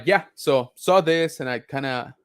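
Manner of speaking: ordinary talk, nothing marked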